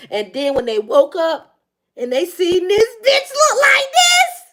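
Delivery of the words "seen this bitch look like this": high, loud, rising squeal; amused outcry. Real, not acted. Reader mocking what they woke up to, squealing. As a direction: build to a shrieked punchline, slowly. Mocks sight of the partner.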